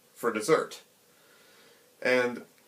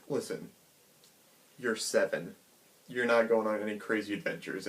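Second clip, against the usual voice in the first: gentle scolding; 2 semitones lower, 7 dB quieter than usual. Retold or imitated. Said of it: "a voice of reason".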